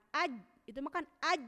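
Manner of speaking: "aj" cut off sharply; stopped aj demo. Shown as a demonstration. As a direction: clip the j short. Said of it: "tertahan ya".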